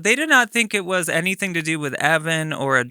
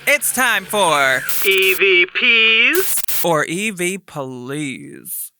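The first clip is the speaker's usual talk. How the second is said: announcer voice; 4 semitones higher, 4 dB louder, slower than usual. Acted. Introducing a show segment.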